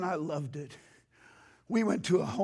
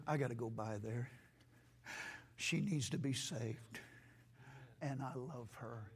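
tender quote; 7 semitones lower, 11 dB quieter than usual. Retold or imitated. Jesus telling his disciples he loves her, softer and lower. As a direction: say it gently, as a quiet promise. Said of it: "What Jesus said".

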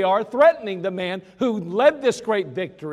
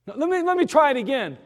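fast, higher pleading; desperate fugitive; 7 semitones higher, 4 dB louder, faster than usual. Acted. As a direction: rush to ask for another go. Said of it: "desperate Ephraimite retrying".

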